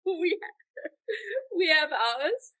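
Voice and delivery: high-pitched, breaking into laughter; laughing too hard to get the words out. Real, not acted. Laughing after the mock question.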